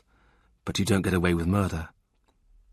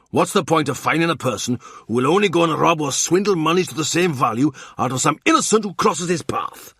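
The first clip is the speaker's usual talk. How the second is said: Morgan voiced; 6 semitones higher than usual. Morgan rants about punishing Kat's father, raised voice. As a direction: rail against the father.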